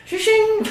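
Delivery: high ringing shing; sword being drawn. Retold or imitated. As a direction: ring it out like a blade leaving its sheath. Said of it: "I'll be like".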